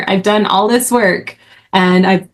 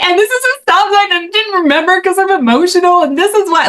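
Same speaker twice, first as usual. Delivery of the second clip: high-pitched emotional pleading, much higher; teary plea to cop; 11 semitones higher than usual. Retold or imitated. A driver pleading tearfully with the cop who pulled her over, pitched high. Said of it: "I was like".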